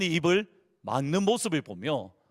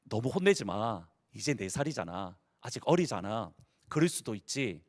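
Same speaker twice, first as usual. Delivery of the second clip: put-on calm the angry older girl down gently; gentle father; 7 semitones lower, 5 dB quieter than usual. Pastor father calming his angry older daughter, softly. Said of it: "목사님이 뭐라고 하냐면".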